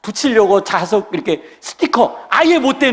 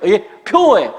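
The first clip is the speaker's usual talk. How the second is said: usual speaking voice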